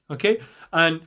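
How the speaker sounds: normal talking voice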